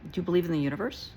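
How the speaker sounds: ordinary talk, nothing marked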